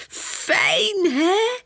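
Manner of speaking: put-on very high little-girl squeal; gleeful squeal. A narrator's reading. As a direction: squeal it with delight like small children. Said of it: "gillen Pum en Poem".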